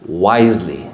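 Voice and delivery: "wisely" dropped very low and set apart; stress on WISELY. Shown as a demonstration. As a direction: land "wisely" low and deliberate after a pause. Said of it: "I'm emphasizing about wisely".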